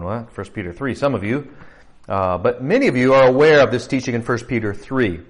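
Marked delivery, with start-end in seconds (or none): 0.0-1.0 mock confusion. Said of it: "And you're going".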